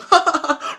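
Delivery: high-pitched fake laugh; mocking laugh. Host scoffs at the fourth-dimension idea, mocking laugh. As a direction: laugh it off in a high, scornful burst.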